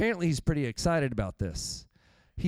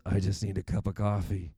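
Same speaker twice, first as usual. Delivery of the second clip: worn-out morning self; 8 semitones lower than usual. Retold or imitated. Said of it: "my thought was".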